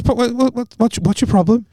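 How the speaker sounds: high-pitched retold challenge to the referee; confrontational. Acted quarrel with the referee.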